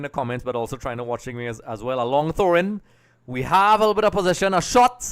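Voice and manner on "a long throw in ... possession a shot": higher, louder, rising as the shot comes; excited live commentary. Real, not acted. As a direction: call the chance as it builds to a shot. Live chance on goal.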